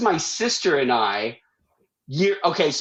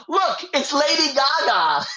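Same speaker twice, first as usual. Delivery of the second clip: put-on excited kid; 10 semitones higher than usual. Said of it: "some kid yells".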